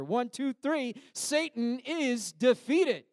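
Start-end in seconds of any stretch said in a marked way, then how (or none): 1.1-3.0 Satan is defeated, shouted high and slow; loud declaration. Shown as a demonstration. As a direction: call out Satan is defeated slowly and loudly. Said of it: "just for good measure".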